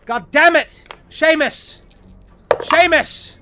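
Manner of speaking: high, loud yelling at a man who won't respond; furious shouting. Acted. Tomanthi yelling at the dazed engineer Seamus, furious shout. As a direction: bellow at him to snap out of it. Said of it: "you hear Tomanthi".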